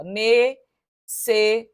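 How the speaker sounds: ne-ces-sá-ria, each syllable spoken apart slowly; split into syllables. Shown as a demonstration. Teacher showing students the syllable split, slow and spaced. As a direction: spell out necessária syllable by syllable. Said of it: "a separação vai ser, ó".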